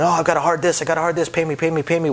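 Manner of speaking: impatient gambler calling for pay; mock yelling player. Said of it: "so don't start yelling".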